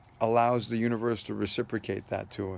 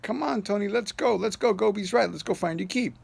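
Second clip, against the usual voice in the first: eager urging; 10 semitones higher than usual. Retold or imitated. Chad urging Tony, voiced.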